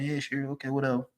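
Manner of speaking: dismissive mumble. Retold or imitated. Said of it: "I'm like".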